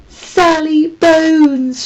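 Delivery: high, loud, drawn-out shout; Holly startles Tam with a slow, loud prank shout. Acted. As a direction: shout it slowly to startle her. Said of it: "shouted Holly".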